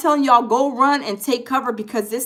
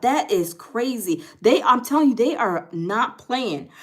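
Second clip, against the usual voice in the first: ordinary talk, nothing marked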